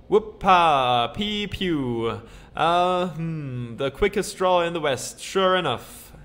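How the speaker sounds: put-on Western cowboy accent with shooting noises; cowboy boast. Gabby bragging about his gun, cowboy accent. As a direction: show off the quick draw.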